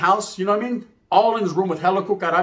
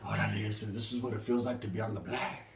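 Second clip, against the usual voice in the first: very soft, low doped voice; sedated mellow; 8 semitones lower, 14 dB quieter than usual. Acted. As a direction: murmur it as if drugged and blissful. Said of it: "gives me a sedative".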